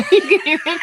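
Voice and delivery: laughing, pitched well up; light laughing line. Real, not acted. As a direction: giggle it out. Laughing about her accent.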